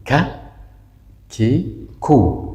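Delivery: "که، کی، کو" slowly as ka, ki, ku; three short vowels. Shown as a demonstration. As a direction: drill "که، کی، کو" one syllable at a time. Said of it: "کاف پیش کو". («که، کی، کو» با زبر، زیر و پیش)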